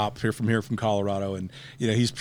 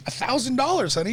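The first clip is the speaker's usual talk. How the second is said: much higher, eager and pleading; excited pitch to wife; 13 semitones higher than usual. Retold or imitated. Husband pitches the gym idea to his wife, high and eager. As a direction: pitch it to her like a giddy kid. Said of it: "I'm like".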